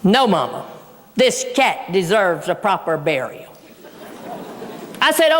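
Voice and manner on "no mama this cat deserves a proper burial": much lower, mock-solemn son; solemn refusal. Son insisting to mom the cat be buried, low solemn refusal. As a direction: drop the pitch low and stand firm.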